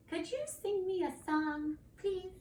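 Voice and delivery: high little dragon voice; pleading young dragon. Acted. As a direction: beg sweetly for a bedtime favor. Third dragon begs for a song.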